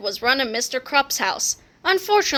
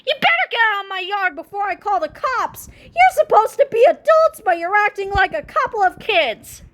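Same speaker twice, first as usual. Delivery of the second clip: furious principal; 8 semitones higher than usual. A narrator's reading. Mister Krupp threatens the old men, furious high shouting. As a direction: rage at them like a grumpy principal. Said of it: "Mister Krupp screamed".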